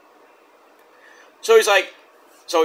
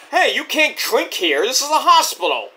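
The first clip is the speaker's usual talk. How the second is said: lightly voiced guard, higher pitch; a hint of an officious guard; security guard; 7 semitones higher than usual. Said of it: "the security guard goes".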